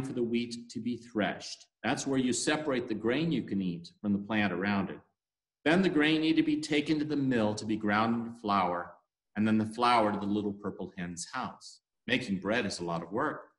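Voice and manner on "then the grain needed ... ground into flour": ordinary delivery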